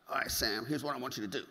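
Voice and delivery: lightly imitated coach, low and a bit soft; low hint of a Mississippi bodybuilder; Patrick Lynn. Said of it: "His voice goes exactly like this".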